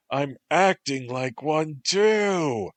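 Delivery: shouting into a pillow; muffled shout. Acted. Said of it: "his muffled voice shouted".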